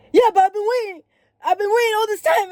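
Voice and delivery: high, loud mock-outraged yelling; shrill, hammed-up tirade; imitated screaming and hollering. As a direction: act out the driver hollering in complaint. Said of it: "Screaming, hooting, and hollering".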